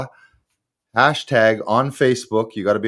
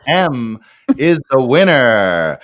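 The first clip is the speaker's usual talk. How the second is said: announcing the winner; 4 semitones higher, 7 dB louder than usual. Real, not acted. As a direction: call out the winner's name.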